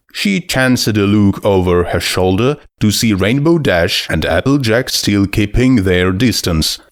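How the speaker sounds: plain reading voice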